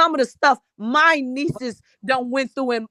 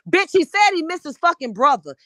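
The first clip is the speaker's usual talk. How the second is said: normal talking voice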